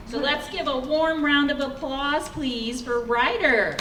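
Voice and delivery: rally the room for the next act; announcing applause. Real, not acted. Host asks audience to welcome Ryder, loud announcing.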